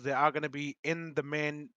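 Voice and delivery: ordinary talk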